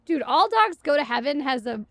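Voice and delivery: ordinary talk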